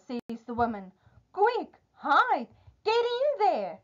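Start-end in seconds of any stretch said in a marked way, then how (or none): 1.3-3.8 high, urgent storybook wife; urgent warning. Acted. Wife hides Jack from her big man, urgent. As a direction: hurry him into hiding, pitch shooting up.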